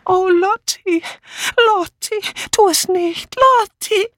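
very high, wailing ghostly plea; plaintive. A narrator's reading. Dead friend's voice begging Lottie to stop, plaintive wail. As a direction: wail it piteously in a thin high voice. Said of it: "ertönte hinter ihr kläglich Dora Banners Stimme".

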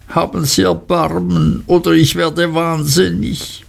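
deutlich höher, klagend; jammernd. A narrator's reading. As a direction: verzweifelt um Erbarmen winseln. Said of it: "jammerte Emly". (Emly fleht Rosa jammernd an)

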